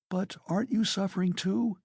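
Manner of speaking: high, pleading cry; Sonia cries out. A narrator's reading. Sonia answering his self-pity, high pleading cry. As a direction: plead with him, voice up. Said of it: "cried Sonia".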